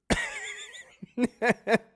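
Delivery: high burst of laughter; loud high laugh breaking out. Real, not acted. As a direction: crack up loudly.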